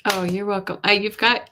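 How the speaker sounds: usual speaking voice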